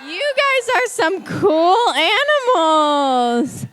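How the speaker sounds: high, drawn-out cheer; enthusiastic praise. Real, not acted. Cheering the children's animal performance.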